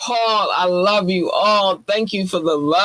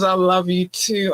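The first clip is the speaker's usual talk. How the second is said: normal talking voice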